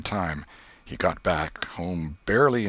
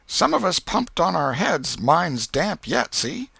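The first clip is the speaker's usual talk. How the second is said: put-on higher, louder, quick clever boy; a boy cheerfully showing off his alibi; Tom; 10 semitones higher, 5 dB louder than usual. A narrator's reading. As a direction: nephew outsmarting his aunt, pleased. Tom forestalls aunt.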